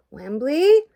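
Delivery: loud, high, rising shout; storming-in shout, swooping upward; angry yell. A narrator's reading. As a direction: yell the name as an angry demand. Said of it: "Gobo yelled, looking very angry indeed".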